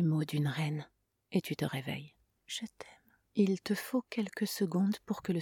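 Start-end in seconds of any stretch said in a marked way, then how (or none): 2.5-3.1 faint close whisper; voice coming closer. A narrator's reading. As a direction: whisper it drifting in. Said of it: "Sa voix se rapproche".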